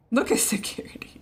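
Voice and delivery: breathy hushed whisper. Real, not acted. Watching the dog, whispering to the viewers. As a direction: point it out in a whisper.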